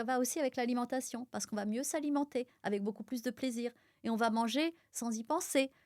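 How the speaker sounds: ordinary talk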